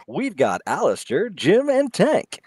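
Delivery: drawn out announcer roll call; fighting game announcer. Pretend. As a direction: call out the fighters, stretched and high. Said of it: "using the announcer voice".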